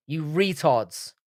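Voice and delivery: normal talking voice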